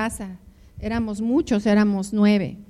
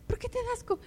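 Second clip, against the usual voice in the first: high, soft, teasing question; high soft imitation of the puzzled husband; mocking husband; 12 semitones higher, 10 dB quieter, faster than usual. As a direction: tease her for feeling sick.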